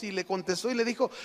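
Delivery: ordinary talk, nothing marked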